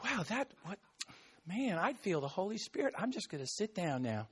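soft, low, half-hearted mumble; a limp, unimpressed murmur; quiet and deflated. Shown as a demonstration. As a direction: mumble it as if nothing happened. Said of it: "Not just like".

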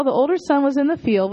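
ordinary delivery

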